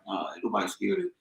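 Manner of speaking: mock high voice; softly retold high-voiced protest; E J/private. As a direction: imitate a man whose voice shot up. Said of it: "voice got high as a bitch".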